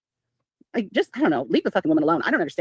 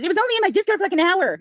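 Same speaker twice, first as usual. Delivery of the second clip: much higher whiny mock voice; mocking Laura's excuse in a high put-on voice; 8 semitones higher than usual. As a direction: whine it like a caught liar making excuses. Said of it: "Laura started making all these excuses".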